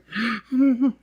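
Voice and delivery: high hammed-up gasp; mock gasp. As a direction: fake a dramatic gasp. Acted gasp in roleplay.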